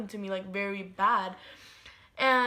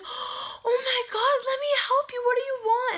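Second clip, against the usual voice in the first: scared coworker; 14 semitones higher, 4 dB louder than usual. Retold or imitated. Pregnant coworker panicking at the bleeding narrator, high and alarmed. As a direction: gasp it out like she's seeing something awful.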